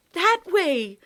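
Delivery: high, loud pointing yell. Acted. Boy pointing out where Lindy is, loud yell. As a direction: shout the direction to them. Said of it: "Tom yelled".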